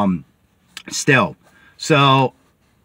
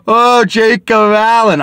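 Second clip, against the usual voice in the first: high, loud, drawn-out mock wail; mock crying; 9 semitones higher, 7 dB louder, slower than usual. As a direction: whine it slowly like a fake crybaby. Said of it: "that wanna cry".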